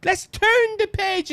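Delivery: high, airy mock politician; singsong slogans, stretched out; Kamala Harris. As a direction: imitate her in a lilting high voice. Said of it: "she's trying to say".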